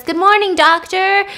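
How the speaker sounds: bright high cheery greeting; chirpy neighbor calling hello; neighbor's greeting. Retold or imitated. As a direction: sing out a friendly good morning.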